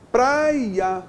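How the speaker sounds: "Praia" said long, in two parts, prai-a; demonstrative "Praia" with the second syllable set apart; split syllables. Shown as a demonstration. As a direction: divide "Praia" before its final a.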